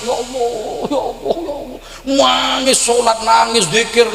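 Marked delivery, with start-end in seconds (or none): 0.1-1.8 high, soft mock sobbing prayer; imitated weeping. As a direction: imitate someone weeping to God. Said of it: "ini nangis". (menirukan orang menangis berdoa)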